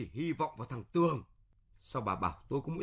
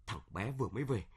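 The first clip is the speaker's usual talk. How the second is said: normal talking voice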